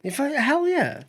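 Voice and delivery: mock hype. Emphatic agreement, put-on voice.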